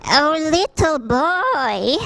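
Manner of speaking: put-on high, slow, trembling old woman; shaking old voice. A narrator's reading. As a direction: plead to the little boy with a quavering voice. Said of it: "she said with voice shaking".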